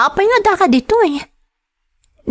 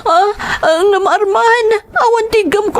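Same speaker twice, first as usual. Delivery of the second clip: high, breaking voice close to sobbing; tearful pleading; 5 semitones higher than usual. Acted. Wife begging her husband Arman for mercy, crying. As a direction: beg him through tears. Wife says she has no money.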